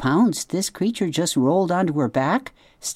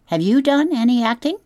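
high, louder eager question; eager opossum; 10 semitones higher, 4 dB louder than usual. Acted. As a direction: perk up, keen to hear. Opossum eagerly asks robot.

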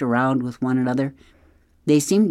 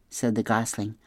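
ordinary delivery, nothing marked

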